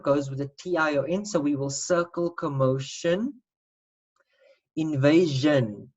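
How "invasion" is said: "Invasion" slow, stressing the zh-un end; modelled word. Shown as a demonstration. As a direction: draw out "Invasion" slowly. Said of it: "That makes a zhin sound".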